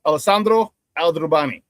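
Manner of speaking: ordinary talk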